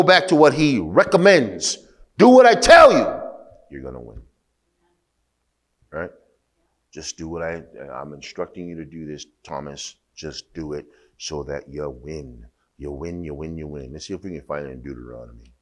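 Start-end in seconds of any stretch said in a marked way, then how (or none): 2.2-3.1 high, loud command on tell; booming God command; commanding shout. Acted. Voicing God's command. 3.7-13.9 gentle instruction. Acted. God instructing Thomas gently.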